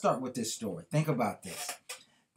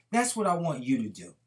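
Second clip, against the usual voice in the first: normal talking voice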